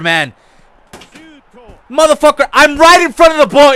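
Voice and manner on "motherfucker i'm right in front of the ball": furious yell, higher and louder; raging gamer shout at his player; angry shout. Real, not acted. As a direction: bellow it at the screen. Raging at teammate AI.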